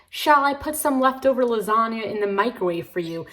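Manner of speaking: prissy fake English accent, mocking the book's dialogue; mock British accent. Mocking the heroine's fake English accent in a book review. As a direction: put on a fussy British accent to poke fun. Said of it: "talking in this, like, European accent".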